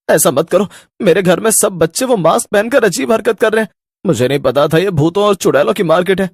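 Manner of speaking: high, loud begging; desperate plea. Acted. Father begging the ghosts to spare his children, high and desperate. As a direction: beg the ghosts to undo it.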